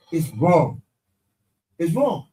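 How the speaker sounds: ordinary delivery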